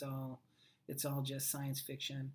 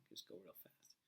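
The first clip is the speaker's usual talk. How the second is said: whisper; 8 semitones lower, 22 dB quieter than usual. Pretend. Aside while playing with effects.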